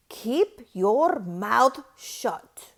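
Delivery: slow, loud, menacing order; stern command. A narrator's reading. Strict teacher silencing the class, slow and loud. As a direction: bark it slowly at the kids.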